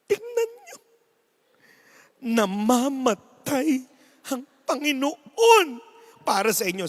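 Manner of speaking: milk the moment tenderly; dramatic announcer. Acted. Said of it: "Sabi nung announcer".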